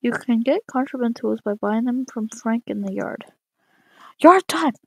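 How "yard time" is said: high, loud outburst; loud exclamation. Real, not acted. Exclaims at yard time in game.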